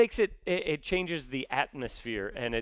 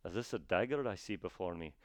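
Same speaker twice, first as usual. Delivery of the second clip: low, soft mock-Scottish Shakespeare line; Scottish brogue; 6 semitones lower, 9 dB quieter than usual. Shown as a demonstration. Actor testing Macbeth in a brogue, low Scottish accent. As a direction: recite it quietly in a put-on Scottish accent. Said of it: "it doesn't quite work as well".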